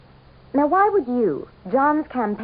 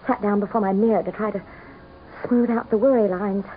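story narration. A narrator's reading. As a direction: recount your past like a confession. Narration resumes after scene.

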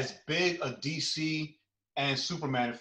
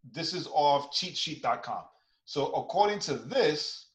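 ordinary talk

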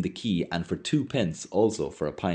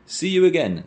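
calling farewell; 11 semitones higher, 6 dB louder than usual. Acted. Haynes calls farewell.

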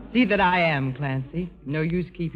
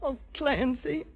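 softer, slow, despairing plea; anguished sigh; 5 semitones higher, 7 dB quieter, slower than usual. Acted. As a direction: plead for help, half broken. Jane torn between two men.

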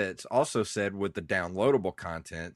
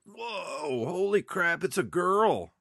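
high-pitched mock astonishment, building louder; amazed; 10 semitones higher, 3 dB louder, slower than usual. Podcaster reliving the Samus reveal as a kid, amazed. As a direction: act out a kid's mind being blown.

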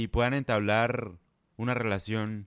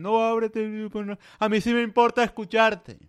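high-pitched sarcastic parody; mocking women; 8 semitones higher than usual. Mocking women's advice to open up, high sarcastic voice. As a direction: mimic them, pitched up and dismissive. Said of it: "las mujeres dicen".

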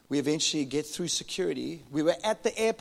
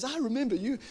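laughing reply; 6 semitones higher, 5 dB louder than usual. Retold or imitated. Cap guy's reply.

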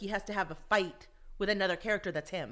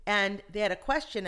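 ordinary talk

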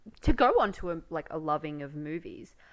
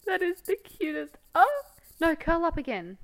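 pet talk; 11 semitones higher, 4 dB louder than usual. Real, not acted. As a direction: coo at a puppy. Talking to the dog.

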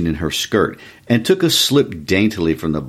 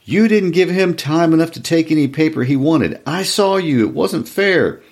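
high-pitched, indignant outcry; a woman crying out that she's been wronged; shouted protest; 11 semitones higher than usual. A narrator's reading. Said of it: "Tessie Hutchison shouted to Mister Summers".

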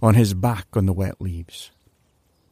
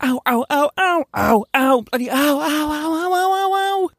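higher and higher yelps of pain; pained ows. Acted. Larry begging for mercy in pain, rising yelps of ow. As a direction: yelp ow rising ever higher in agony. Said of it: "This sounded something like".

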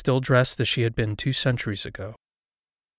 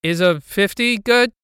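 high, loud question called across a room; calling out; 9 semitones higher, 7 dB louder than usual. A narrator's reading. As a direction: call it out to someone unseen. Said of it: "I called".

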